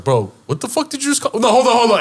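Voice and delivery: high, indignant retold outburst; making a scene. Host confronting guest at own party, making a scene. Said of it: "And I made a scene".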